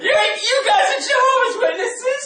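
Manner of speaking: laughing shout. Real, not acted. Laughing outburst to audience.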